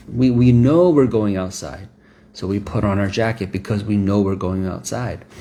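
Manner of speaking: ordinary talk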